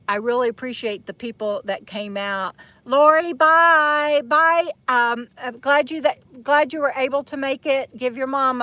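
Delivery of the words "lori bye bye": drawn-out, louder farewell called across the campsite; calling out goodbye. Real, not acted. Vlogger calling goodbye to departing friend Lori, drawn-out and loud. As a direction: stretch the bye out to a friend walking away.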